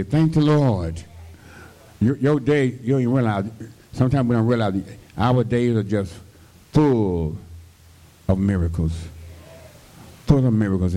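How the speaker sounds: rising, fervent preaching voice. Acted. Preacher stirs the congregation about daily miracles, fervently. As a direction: lift the church with each phrase.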